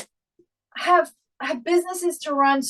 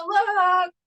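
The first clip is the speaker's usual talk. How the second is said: put-on child's voice; 6 semitones higher than usual. Toddlers pointing out the pee.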